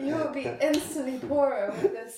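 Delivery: loud, laughing sales pitch; laughing salesman pitch. Pretend. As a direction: sell it while cracking up. Mock sales pitch to friend.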